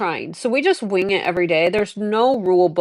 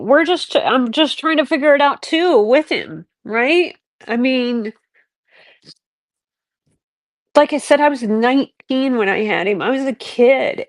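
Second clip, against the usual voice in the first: emotional; 5 semitones higher than usual. Real, not acted. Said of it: "I don't know why I got emotional".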